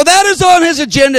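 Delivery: ringing prophecy at full force; prophetic shout. Retold or imitated. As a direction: proclaim it to the whole arena. Prophecy continues.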